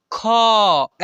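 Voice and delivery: ख said full and loud with its vowel, khə; full kha sound. Shown as a demonstration. Teacher showing students the full ख sound. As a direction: open ख out into a full loud syllable. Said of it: "पूर्ण रूप से उच्चारण कीजिएगा तो".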